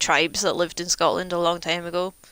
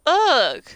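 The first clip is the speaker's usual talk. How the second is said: groan of annoyance; 10 semitones higher, 9 dB louder than usual. Real, not acted. Annoyed by the golems.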